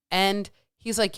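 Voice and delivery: normal talking voice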